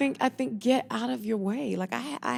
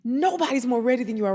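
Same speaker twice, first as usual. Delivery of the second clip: rapid, urgent persuasion; fast insistent colleague; 4 semitones higher, 6 dB louder, faster than usual. Retold or imitated. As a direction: rush to convince her. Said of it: "She goes".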